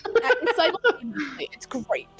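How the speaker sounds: high, loud, fast gushing; gushing excitement. Real, not acted. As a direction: rave about her, thrilled.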